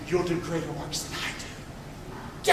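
whispered, slow, soft words of Jesus; a hushed, intimate promise; hushed quote. Retold or imitated. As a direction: breathe the promise to them.

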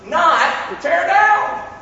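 "not tear down" dragged out slowly, high. Shown as a demonstration. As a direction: stretch every word for emphasis. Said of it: "watch this".

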